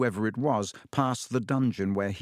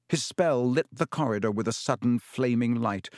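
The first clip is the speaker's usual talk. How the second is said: ordinary delivery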